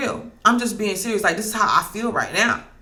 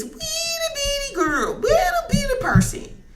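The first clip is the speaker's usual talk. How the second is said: high mock voice. Mocking the small girl.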